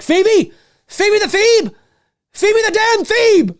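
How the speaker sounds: high-pitched mock-desperate calling out; pretend frantic calling. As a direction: call out a name in escalating mock panic. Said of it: "Imagine he runs and he's like".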